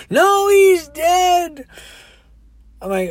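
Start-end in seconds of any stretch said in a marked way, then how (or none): 0.1-1.7 high, loud, slow mock wail; hysterical crying. Crying woman answers her doubting driver, hammed-up wail. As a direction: stretch it into an exaggerated bawl. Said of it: "She's crying".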